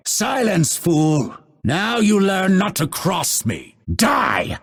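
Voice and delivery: high-pitched commanding fury; indignant battle outburst; angry combat threat. Acted.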